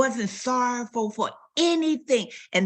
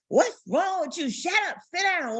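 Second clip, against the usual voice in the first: mock scolding; 10 semitones higher than usual. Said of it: "And we say".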